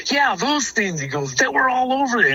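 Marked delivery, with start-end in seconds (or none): 0.1-1.0 high, jumpy retold voice; imitating scared brother. Little brother confirming what he saw, imitated as scared. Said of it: "And he was scared". 1.3-2.3 very high, rattled retelling; imitating scared brother. Little brother reporting the animals to his older brother, imitated as scared. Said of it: "And he was scared".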